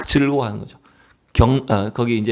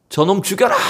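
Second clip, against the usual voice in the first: higher-pitched mock yell; retold crowd shout; 6 semitones higher than usual. Crowd turning on the monk, imitated yell. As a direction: imitate an angry mob's cry, only half-shouted. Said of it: "막 소리치는 거죠".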